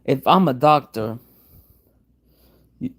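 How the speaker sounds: ordinary talk, nothing marked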